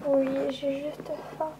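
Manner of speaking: aveu doux. Acted. Vampire répond doucement, lente.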